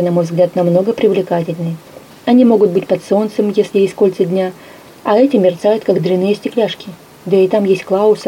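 usual speaking voice

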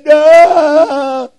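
loud, high, drawn-out wailed "no"; re-enacted crying wail. Preacher reliving his crying before God, acted loud wail. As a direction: wail it out as if sobbing, overwhelmed. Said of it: "yo lloraba, lloraba y yo decía".

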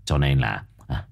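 put-on low, hesitant wheedling old man; old Quý fawning. A narrator's reading. Quý wheedling money from the mother, low. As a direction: hem and haw while angling for cash, low. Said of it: "lão quay sang cười".

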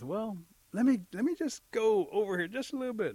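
wheedling stalling. Acted. Turkey edging away with the trophy, wheedling stalling.

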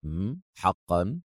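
low, soft, slow scoff; unimpressed drawl; dismissive. A narrator's reading. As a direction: brush him off with contempt. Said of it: "في استخفاف".

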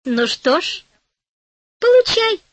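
high, loud mocking fairy; fairy punishing the greedy old woman. A narrator's reading. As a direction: smirk it out like a spiteful little fairy. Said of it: "усмехнулась фея".

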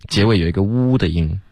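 ordinary talk

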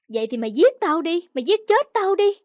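loud, high-pitched taunt; defiant dare. A narrator's reading. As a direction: scream back, daring him. Choked wife defiantly dares husband.

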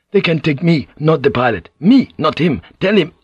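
high, loud and insistent; urgent plea. A narrator's reading. Prisoner offering himself instead of the pilot, urgent plea.

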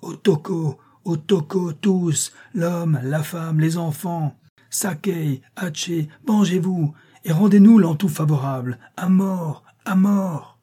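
high-pitched, ringing rallying cries; frenzied yelping cry. A narrator's reading. Madman urging the crowd to vengeance, frenzied cry. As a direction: rouse the mob like a bugle call.

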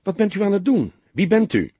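put-on startled young woman confronting strangers, alarmed. A narrator's reading. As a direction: demand answers, flustered.

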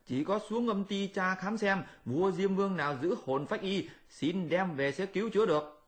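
sly proposal, voiced high and quiet; lightly pitched Monkey King. Monkey explains his cure.

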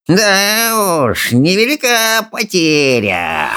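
high, slow, drawn-out sneer; snarling dragon. A narrator's reading. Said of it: "ощерилась Огневица с противным скрежетом".

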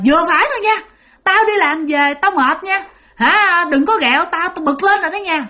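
retold angry shout at the ghost dogs; angry yell. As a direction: snap at them like a tired, irritated father. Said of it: "ba mới la lên, ba mới nạt lên".

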